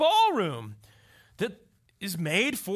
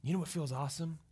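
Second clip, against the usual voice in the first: ordinary delivery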